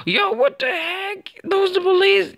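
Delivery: gasp, then high, slow shocked cry; startled. Real, not acted. Gamer spotting police cars, gasping high shocked outburst. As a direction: caught off guard, voice shooting way up. Police suddenly appear in game.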